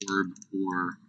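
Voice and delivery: ordinary delivery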